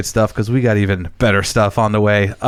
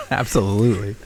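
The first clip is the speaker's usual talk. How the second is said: laughing; 6 dB quieter than usual. Real, not acted. Laughing at guest's remark.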